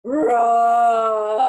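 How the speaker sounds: a big playful tiger roar, loud. Acted. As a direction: roar like a wild tiger for the kids. Tiger roars happily.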